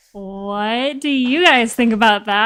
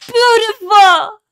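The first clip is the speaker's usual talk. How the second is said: gushing; 10 semitones higher, 8 dB louder than usual. Real, not acted. Gushing over the audio drama voice.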